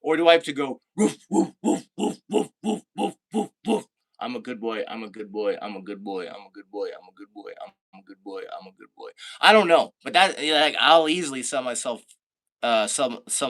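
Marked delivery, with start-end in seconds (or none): 1.0-3.9 higher playful woof woofs; mock barking. Streamer barks for chat's dare, higher mock woofs. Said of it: "Bark seven times". 4.2-9.1 put-on fading good boy. Streamer finishes chat's dare, lower and fading to a whisper. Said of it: "Bark seven times while saying".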